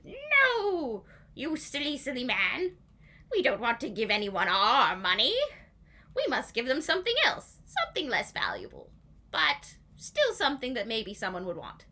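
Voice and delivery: mock-exasperated sheriff; scolding villain. Sheriff correcting the king, high loud scolding.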